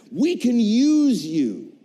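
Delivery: slow, loud, heavy declaration; slow emphatic. Retold or imitated. Director telling the actor they can use him, slow and loud. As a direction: land each word slowly like a verdict. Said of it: "The words I have been waiting for".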